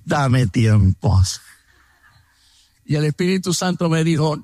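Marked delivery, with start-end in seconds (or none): 0.0-1.2 dame tiempo. Retold or imitated. Frase típica al no querer algo.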